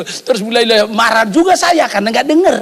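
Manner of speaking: usual speaking voice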